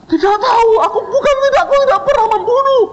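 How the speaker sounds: high, loud, fast pleading; panicked denial. Retold or imitated. Terrified student pleading he killed nobody, high and frantic. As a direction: plead your innocence in a panic.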